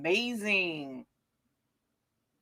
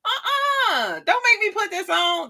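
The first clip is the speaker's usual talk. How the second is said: playful; 13 semitones higher than usual. Real, not acted. Teasing herself, high voice.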